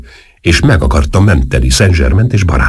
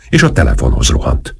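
ordinary delivery

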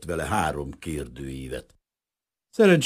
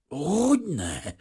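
higher, glad quick agreement; eager relief; 10 semitones higher than usual. A narrator's reading. Refugee eagerly agreeing with the official. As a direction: grab the word like a lifeline. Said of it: "örvendezett meg Vrana Bazil".

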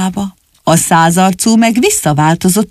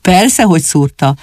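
ordinary delivery, nothing marked

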